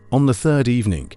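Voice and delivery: usual speaking voice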